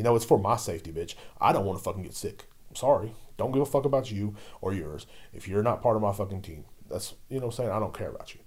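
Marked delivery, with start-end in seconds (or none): none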